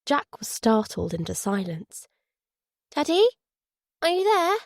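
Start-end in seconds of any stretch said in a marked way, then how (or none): 2.9-4.6 put-on high, uncertain child's voice; small child checking someone is listening; little girl. A narrator's reading. Daughter seeks her silent father on the phone, high and unsure.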